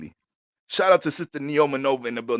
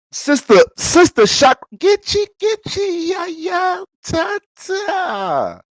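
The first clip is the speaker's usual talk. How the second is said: high, slow, shouted preacher's cry, breaking into babble; preacher hollering; 9 dB louder, much slower than usual. Acted. Pastor calling out to Sister Shock, shouting in tongues-like babble.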